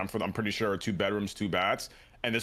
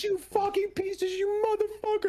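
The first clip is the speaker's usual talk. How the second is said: imitated screaming, crying. Neighbor raging at cheating boyfriend, mock screaming high. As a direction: imitate a hysterical woman, way up high. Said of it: "She's screaming, crying".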